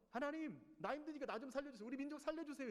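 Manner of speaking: a desperate prophet calling for help; pleading prayer. Acted. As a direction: cry out to God for rescue. Prophet begging God.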